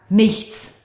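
a loud, curt imitation of a sulking child; sulky nichts. Mother imitates daughter's sulky reply, loud and curt. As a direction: toss out Nichts like a stroppy kid.

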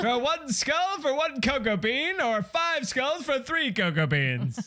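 high hammed-up fairground barker. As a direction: hawk the game to passing crowds. Hawking skull-throwing game at fair.

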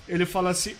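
ordinary talk

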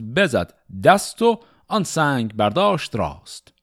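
measured, rhythmic reading of Persian epic poetry; شعرخوانی حماسی; epic verse recitation. Acted. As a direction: recite it like a storyteller of old epics.

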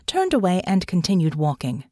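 ordinary delivery, nothing marked

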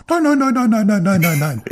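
high rapid nein nein nein, fading; hammed-up frantic no no no; mock alarmed denial. Joking denial of a man present.

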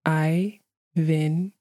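Ævintýri said very slowly, syllable by syllable; over enunciating. Shown as a demonstration. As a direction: stretch out Ævintýri slowly and carefully. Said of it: "I'll say it slower".

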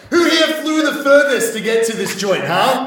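loud cheesy comic greeting the crowd; brash emcee. Acted. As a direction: work the crowd like a cheesy comedian. Comedian host working the crowd.

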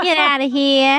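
loud, high Boston accent. Shown as a demonstration. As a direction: say it like a Boston local. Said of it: "With a Boston accent".

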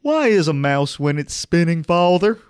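high simple-minded son voice; dim country boy. Acted. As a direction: ask it like a dopey farm boy. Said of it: "the son just goes".

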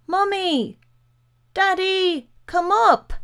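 high-pitched frightened yell for parents; high, drawn-out panicked calls; child's scream. A narrator's reading. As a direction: scream for help as a terrified boy. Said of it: "Suddenly he screamed".

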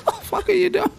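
high, fast, laughing through the words; cracking up in disbelief. Real, not acted. Mocking a crying comedian, high and laughing.

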